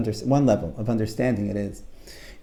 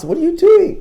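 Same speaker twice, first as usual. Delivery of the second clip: high, loud startled question; shocked, pitched way up; alarmed story frog; 8 dB louder than usual. Acted. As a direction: squawk it in disbelief. Frog confronting screaming Toad.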